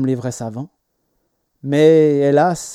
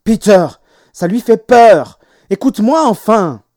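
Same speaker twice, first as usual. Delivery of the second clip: loud, raised-pitch telling-off; exasperated scolding; 6 semitones higher, 9 dB louder than usual. A narrator's reading. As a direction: scold the child loudly, insisting on being heard. Mother scolding her son.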